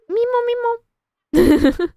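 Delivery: high-pitched "meemo" squeak; goofy high babble; silly nonsense noise. Real, not acted. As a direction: squeal a silly two-note gibberish sound. Silly noise for self-amusement.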